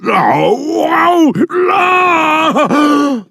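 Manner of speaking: very high yelping ow; cries of pain. Acted. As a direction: yelp sharply as the thorn comes out. Thorn pulled from lion's paw.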